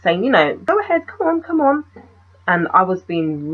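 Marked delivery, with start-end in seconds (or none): 0.6-1.8 mock gallant gent, much higher; English gentleman. The stranger ushering her down the stairs, mock gentleman voice. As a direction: play the over-polite English gentleman, pitched up. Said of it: "being an English gentleman".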